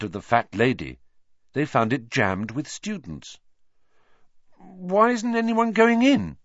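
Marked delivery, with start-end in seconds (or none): 4.6-6.4 higher, puzzled question; curious. A narrator's reading. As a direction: ask it with curiosity. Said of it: "said Ron curiously".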